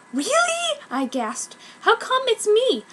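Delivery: very high thrilled squeal; can't believe it's you; breathless disbelief. Acted. Sakura amazed at being chosen.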